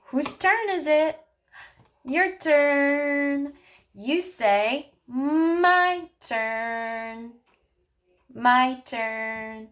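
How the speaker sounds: put-on speech therapist modeling turn-taking for a child, sing-song. Shown as a demonstration. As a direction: model it slowly and playfully for a toddler. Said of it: "you could have them say".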